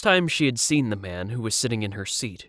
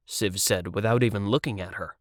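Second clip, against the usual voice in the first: ordinary delivery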